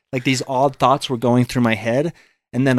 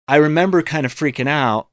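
ordinary talk, nothing marked

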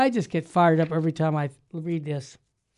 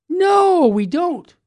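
emphatic No; 9 semitones higher, 5 dB louder, much slower than usual. Real, not acted. Preacher answering rhetorical question.

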